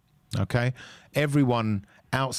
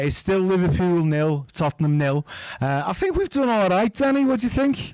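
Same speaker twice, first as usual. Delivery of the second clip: put-on high, whiny Scouse accent; 7 semitones higher than usual. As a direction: whine it out like a Liverpool pundit. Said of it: "keep talking in the Scouse accent".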